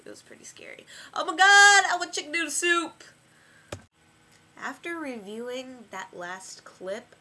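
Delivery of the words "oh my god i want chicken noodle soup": whiny yell. Acted. Sudden whiny outburst.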